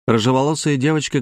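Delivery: plain reading voice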